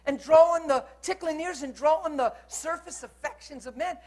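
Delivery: high, loud, impassioned preaching; forceful preaching. Real, not acted. Preacher defending his straight preaching to the congregation, forceful delivery. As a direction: press every point on the congregation.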